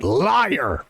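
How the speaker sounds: low, accusing cry of liar; kid yelling liar. Retold or imitated. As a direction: call the clown a liar like a bratty kid. Kid calling out clown.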